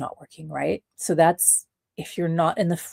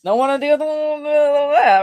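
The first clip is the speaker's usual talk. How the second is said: spooked onlooker; 8 semitones higher, 5 dB louder than usual. Pretend. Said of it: "they're like".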